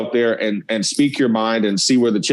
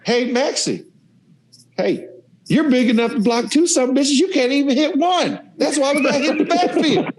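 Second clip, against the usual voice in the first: roast the lineman in a much higher mocking voice; impression of Coach Spurrier; 12 semitones higher than usual. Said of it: "he goes".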